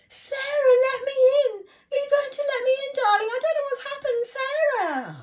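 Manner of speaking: very high, loud pleading caricature; mock wailing plea. Imitating the man begging at the door, high mock wail. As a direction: wail like a locked-out lover, hammed-up.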